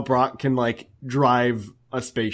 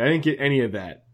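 ordinary talk, nothing marked

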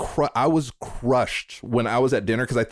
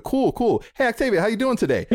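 high, quick, cheery re-greeting; oblivious chirpy greeting; 11 semitones higher than usual. Retold or imitated. Mocking own forgetfulness to a friend, oblivious and chirpy. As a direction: breeze straight back into the same hello. Replaying the same question, forgotten already.

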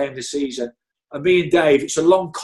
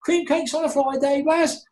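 mimicked boss voice; 12 semitones higher than usual. Boss teasing Baz about Friday cakes, high mock voice. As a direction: pipe it up high, teasing the kitman. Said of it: "the same thing".

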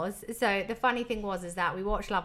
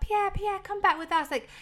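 mock calling out; 8 semitones higher than usual. Girls calling Pierre to come home.

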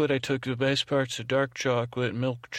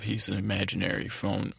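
ordinary talk, nothing marked

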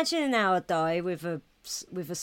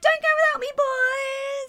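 much higher, squeaky girly imitation; high mock voice. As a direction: squeak it out like a fan. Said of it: "she was like".

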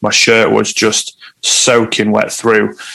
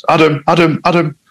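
lightly voiced mum calling out, pitched higher; a hint of mum anxiously calling Adam, higher; mum calling his name; 6 semitones higher than usual. Mum calls him on the phone.

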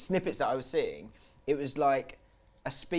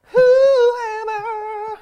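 game show announcer; 12 dB louder, much slower than usual. Acted. Quiz segment jingle-like announcement.

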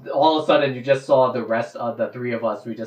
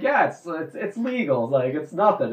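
higher, breezy, a bit hammed-up; casual reassurance; 6 semitones higher than usual. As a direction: wave it off like it's no big deal. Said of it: "we're like".

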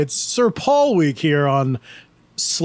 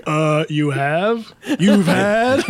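slow, stunned mock Liverpool voice; drawn-out disbelieving Beatle; [name] impression; much slower than usual. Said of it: "George was like".

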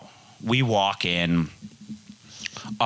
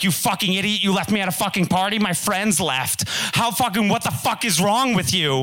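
furious yell; 10 semitones higher than usual. Retold or imitated. Angry girlfriend yelling in story.

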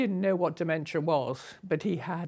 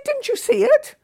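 much higher and louder, imitating a shrill reproach; mock scolding hostess; 10 dB louder than usual. Hostess scolding her guest about the snake, mock high indignation. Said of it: "And she said".

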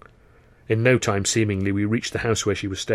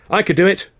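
quick, loud, higher blurt; 10 semitones higher, 9 dB louder than usual. A narrator's reading. Chaplin grabbing the lead role, blurting eagerly. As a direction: jump in before anyone else. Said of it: "Chaplin blurted out quick as a flash".

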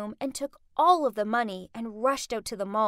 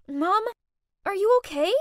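concerned; 6 semitones higher, 6 dB louder than usual. A narrator's reading. Daughter helping her fallen mother up.

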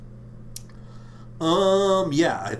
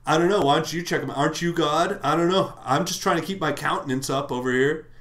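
ranting Cain; 3 semitones higher, 4 dB louder than usual. Retold or imitated. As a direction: let Cain blow up at God. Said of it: "He says".

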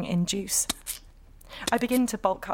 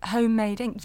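ordinary delivery

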